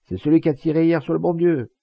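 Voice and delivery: high, loud shout from the crowd; crying out. A narrator's reading. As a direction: shout the answer over the crowd. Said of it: "Une voix cria". (cri aigu dans la foule)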